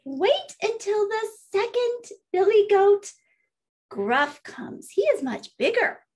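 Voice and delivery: high little goat voice; little billy goat. Acted. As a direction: bargain in a small, high voice. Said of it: "said the little billy goat".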